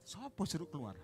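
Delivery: soft, muttered query; puzzled stall owner. Retold or imitated. Stall owner wondering who was told to leave, muttering. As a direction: ask it under your breath, taken aback.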